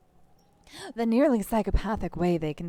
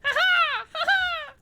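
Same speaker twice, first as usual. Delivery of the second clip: high, loud mocking kid cackle; violent fit of laughter; 6 dB louder than usual. Kuno laughing at the fallen detective, high mocking cackle. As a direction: cackle like seagulls taking flight. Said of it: "violent fit of laughter".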